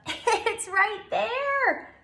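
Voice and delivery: high, slow chuckling tease; high, slow playful chuckle; chuckling squirrel. Acted. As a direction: giggle it out teasingly. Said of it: "chuckled Squirrel".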